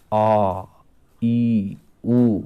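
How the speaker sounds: teacher sounding out long vowels; long vowel demo. Shown as a demonstration. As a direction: draw out आ, ई and उ for the class. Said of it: "दीर्घ संधि स्वर".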